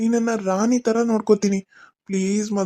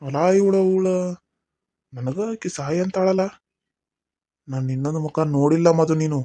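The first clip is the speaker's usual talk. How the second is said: much lower voice in North Karnataka dialect; low threatening aside; 8 semitones lower, 3 dB quieter than usual. Acted. Aside in another voice. (ತಗ್ಗು ಧ್ವನಿಯಲ್ಲಿ ಬೆದರಿಕೆ)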